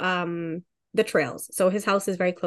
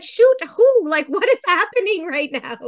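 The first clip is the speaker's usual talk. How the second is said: panicked disbelief; 10 semitones higher, 6 dB louder than usual. Retold or imitated. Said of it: "And I'm like".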